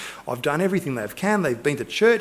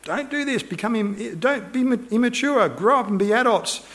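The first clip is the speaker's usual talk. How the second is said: stern rebuke; 7 semitones higher than usual. Retold or imitated. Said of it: "he's hitting them pretty hard around the head".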